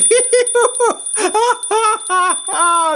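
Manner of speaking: high, bubbling laugh; hearty laughter. A narrator's reading. Flower man tickled by the bug, high hearty laughter. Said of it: "Said the flower man".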